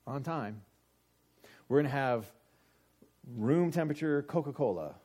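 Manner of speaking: normal talking voice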